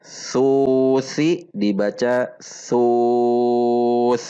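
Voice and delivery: very slow, drawn-out demo reading; slow demonstration. Shown as a demonstration. Teacher slowly models the stop on sus. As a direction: spell it out slowly for students. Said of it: "maka huruf sa disukunkan".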